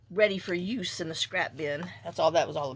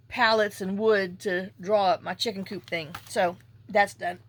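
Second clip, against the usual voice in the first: usual speaking voice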